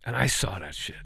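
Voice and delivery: hushed, nearly voiceless; telling the host, an awed whisper. Real, not acted. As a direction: whisper it in awe. Awed aside after the story.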